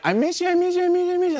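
high falsetto gushing; cooing dog talk. Retold or imitated. Partner greeting her babies, high excited cooing. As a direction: imitate an excited pet owner squealing.

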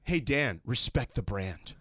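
a hint of a stern boss. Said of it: "And he told them".

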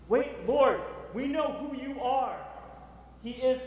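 high, slow fervent appeal; preaching prayer. Acted. Preacher voicing Habakkuk's appeal to the Lord, high and slow. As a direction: lift it up to God slowly.

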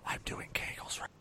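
unvoiced-soft, breath-only whisper. Shown as a demonstration. Player showing how a game card should be read, whisper. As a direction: whisper it very softly, without any voice. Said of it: "You gotta say that in a whisper voice".

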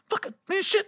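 high, hushed cursing; cowed grumbling. Retold or imitated. Tenant too scared of roommate to complain, grumbling quietly. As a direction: swear softly to yourself. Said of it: "'cause I'm scared of 'em".

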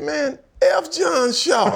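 loud, high, drawn-out mock outrage; retold defiance. Former player cursing the GM to his friend, mock outrage. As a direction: blurt it out in comic indignation.

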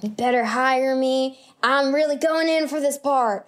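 mock crazed method-actor insistence; crazy actor. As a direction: play the over-committed auditioner, pushy. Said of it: "that crazy actor that's like".

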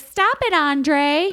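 high-pitched mock female complaint; protesting partner. Host imitates Libby scolding Andre, high mock protest. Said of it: "And she's like".

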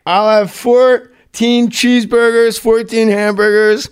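mock Farley listing food at a drive-through; Farley's huge drive-through order. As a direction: read the order slowly, savouring each item. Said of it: "He goes".

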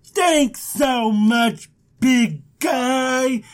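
very slow, drawn-out gratitude; mock-gushing thanks. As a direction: stretch out each word, hammed-up. Abram gushes thanks to God.

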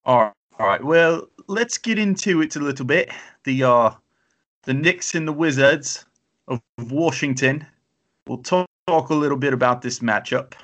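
podcast host slipping into a hammed-up British accent. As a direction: do your British [name] bit. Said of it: "bring out British [name] again".

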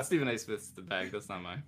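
usual speaking voice

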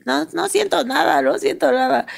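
high-pitched mock insistence; imitating her friend. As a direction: voice a friend protesting, pitched well up. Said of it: "Mónica estaba así de".

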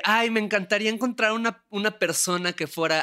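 high-pitched imitation of someone wishing; mock wishing. Voices a friend's wistful wish. (deseo imitado, con voz aguda)

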